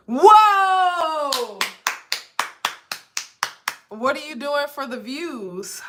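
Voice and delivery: normal talking voice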